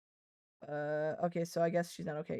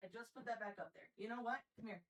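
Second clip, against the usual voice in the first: quiet grumble; 19 dB quieter, faster than usual. Real, not acted. Repeat, quiet after yell.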